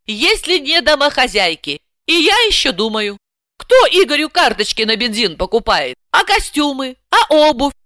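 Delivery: high-pitched, agitated housewife; indignant housewife. A narrator's reading. Housewife arguing to herself that she runs the economy, indignant. Heroine's inner monologue voiced.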